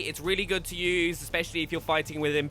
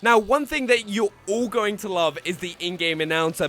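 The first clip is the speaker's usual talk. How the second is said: ordinary delivery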